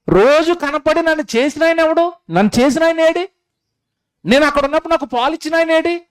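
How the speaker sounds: put-on high, fast, searching cry of a lost baby; frantic newborn. Preacher voicing a newborn, crying out for its maker in high panic.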